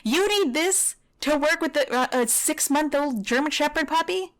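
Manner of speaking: high, outraged question; indignant, disbelieving challenge; incredulous. Real, not acted. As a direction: push the pitch up in disbelief. Incredulous at the trainer.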